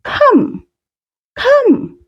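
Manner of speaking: high beckoning call; high sing-song call. Shown as a demonstration. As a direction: come sung out high and bright. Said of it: "The word woza is".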